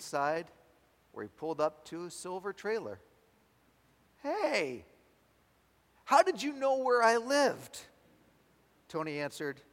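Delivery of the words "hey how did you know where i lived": high-pitched, incredulous demand; acted astonishment. Astonished hitchhiker asking the driver how he knew, high incredulous voice. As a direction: voice jumps up in amazement. Astonished Charlie questions Tony.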